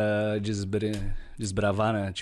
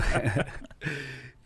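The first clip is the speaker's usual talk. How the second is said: laughing; 4 semitones higher, 4 dB quieter than usual. Real, not acted. Laughs after joking about Portuguese.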